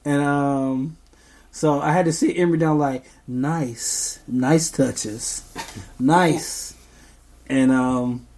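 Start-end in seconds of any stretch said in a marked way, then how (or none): 3.3-6.7 tender parent voice; gentle coaxing. Retold or imitated. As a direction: coax a toddler to be gentle. Teaching toddler gentle touches.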